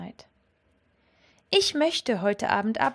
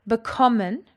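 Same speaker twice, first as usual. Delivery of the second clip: Bekommen with KOM punched louder; stress on second syllable; 5 dB louder than usual. Shown as a demonstration. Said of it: "has got its emphasis on the second syllable".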